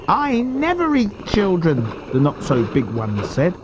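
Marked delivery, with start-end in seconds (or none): 0.1-1.8 high, slow little-croc voice; high-pitched, drawn-out refusal; not-so-big crocodile. A narrator's reading. Smaller crocodile objects.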